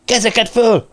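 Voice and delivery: high, forceful command; shouted order. A narrator's reading. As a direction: yell it at an armed suspect. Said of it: "ordította Tony Cartwright".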